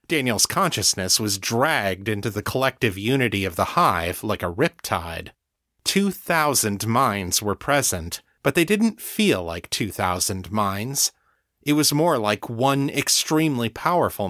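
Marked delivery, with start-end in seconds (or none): none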